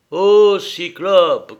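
high, loud, drawn-out hail; shouting. A narrator's reading. Ulysse to the cyclope through cupped hands, shouting. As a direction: hail him slowly across a great distance. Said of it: "cria-t-il".

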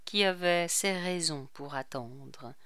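plain reading voice

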